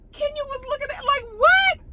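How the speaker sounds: very high, baffled what. Retold or imitated. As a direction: act stunned. Kenya's baffled reaction.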